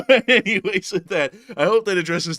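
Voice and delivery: high and loud through a laugh; laughing wrap-up. Real, not acted. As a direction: break out of character laughing. Snaps back laughing.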